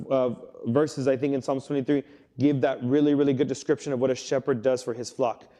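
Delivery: ordinary talk, nothing marked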